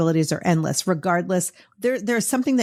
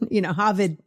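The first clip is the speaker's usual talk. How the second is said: normal talking voice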